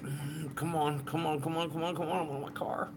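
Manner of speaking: mock impatience. Vlogger mimicking himself waiting for his car, mildly whiny. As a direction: lightly act out restless waiting. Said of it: "I'm just kinda sitting there like".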